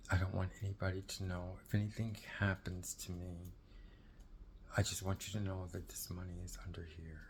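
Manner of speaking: soft whisper, barely voiced; whispered secret. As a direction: whisper it so nobody else hears. Sister whispers hidden money secret.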